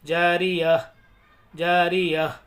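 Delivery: जारिया said apart, each ending in a faint h; deliberate जारिया, falling onto a breathy h; light final h. Shown as a demonstration. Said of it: "हा की आवाज़ आएगी हल्की सी".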